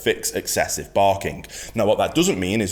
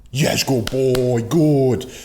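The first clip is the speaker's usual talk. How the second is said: higher, louder, slow happy praise; praising the dog; 4 semitones higher, 4 dB louder, much slower than usual. Shown as a demonstration. Trainer praising the dog for waiting calmly, higher and louder. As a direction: reward him with your voice. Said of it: "And we reinforce".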